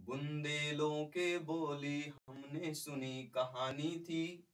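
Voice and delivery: slow, drawn-out recital of the line, each word weighted; poem recital with stress. Shown as a demonstration. Teacher showing students stress in spoken Hindi, slow poetic recital. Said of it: "बोलने के बल पे ऐसे पड़ता है कि इसे बोले".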